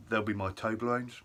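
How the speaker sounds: ordinary talk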